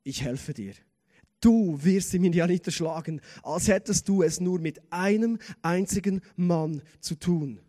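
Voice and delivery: God answering with firm authority; the Lord. Acted. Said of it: "Der Herr sprach".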